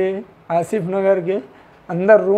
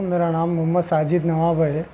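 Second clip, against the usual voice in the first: ordinary talk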